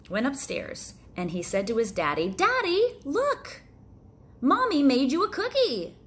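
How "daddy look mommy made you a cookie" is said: put-on high, loud eager child voice; a little boy bursting with news; excited child. Little boy shouting to his daddy about the cookie, high and excited.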